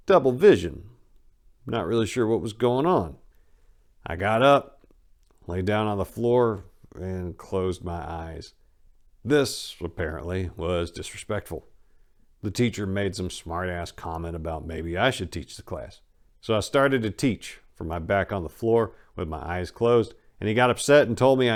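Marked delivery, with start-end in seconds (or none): none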